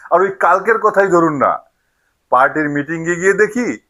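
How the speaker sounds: normal talking voice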